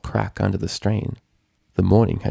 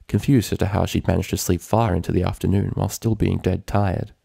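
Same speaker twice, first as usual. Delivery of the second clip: ordinary delivery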